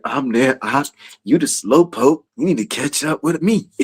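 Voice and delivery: low, easy, unhurried voice; chill Shaquille. Retold or imitated. Preacher imitating Shaquille teasing a latecomer, lightly low and chill. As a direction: keep it relaxed and soft-spoken. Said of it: "Shaquille's so chill".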